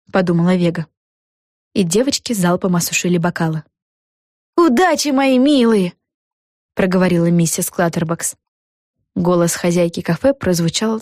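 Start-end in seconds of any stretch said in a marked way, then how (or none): 4.6-5.9 higher, sweet café-owner voice; fond farewell. A narrator's reading. Mrs Clutterbucks seeing the girls off, higher fond voice. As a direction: wish them luck in a raised voice.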